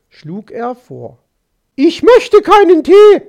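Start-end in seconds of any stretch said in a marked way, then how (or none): 1.7-3.2 loud, high-pitched angry refusal; angry outburst. A narrator's reading. Magpie refusing the fox's offer of tea, angry outburst. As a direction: lash out at him, voice raised and high. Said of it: "fuhr sie ihn an".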